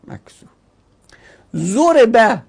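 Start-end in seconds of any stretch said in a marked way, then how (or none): 1.5-2.4 «ضربه» said zo-re-ba, short "o" on the first syllable; damma on Z. Shown as a demonstration. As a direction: pronounce «ضربه» as ZO-re-ba, pitched up. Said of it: "اون او چیه؟". («ضربه» با ضمهٔ ض: ضُرِبَ)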